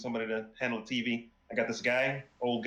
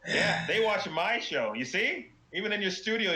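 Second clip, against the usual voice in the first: gloating; 7 semitones higher than usual. Acted. Rival gloating over the host.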